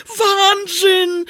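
sehr hoher, lauter Jubel; jubelnd. A narrator's reading. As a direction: jubel vor Freude. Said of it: "jubelte Olaf".

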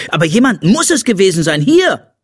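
put-on fast, high, agitated boy's voice; indignant insistence. A narrator's reading. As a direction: press the accusation, rising to a loud peak. Boy angry about plundered piggy bank. (aufgebracht, schnell und hoch)

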